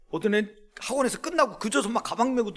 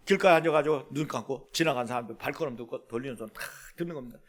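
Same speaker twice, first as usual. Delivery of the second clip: usual speaking voice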